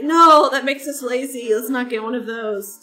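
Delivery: husband refusing. Retold or imitated. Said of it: "And he's like".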